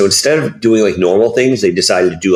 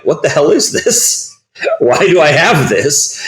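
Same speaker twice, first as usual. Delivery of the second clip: baffled; 7 semitones higher than usual. Retold or imitated. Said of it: "And you're like".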